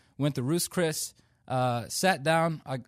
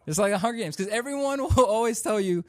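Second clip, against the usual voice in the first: laughing through the line, a bit higher; 7 semitones higher, 4 dB louder than usual. Real, not acted. Comic repeats his joke to the crowd, laughing. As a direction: chuckle as you repeat it.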